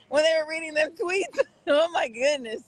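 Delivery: words breaking up with real laughter; can't hold the giggles back while talking; laughing through words. Real, not acted. Giggling to viewers about the tweets being read.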